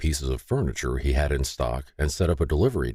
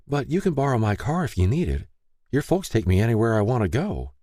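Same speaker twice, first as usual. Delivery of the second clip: higher, gentle woman's voice offering help; kind older woman; 7 semitones higher than usual. Acted. Lay offers Peter her car, gently eager. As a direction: sound eager to help him out.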